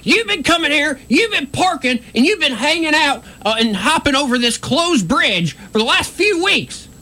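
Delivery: high-pitched accusatory yelling; retold yelling. Storyteller imitating officer accusing them on the closed bridge, yelling. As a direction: rattle off accusations in a high, strained yell. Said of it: "just yelling at me".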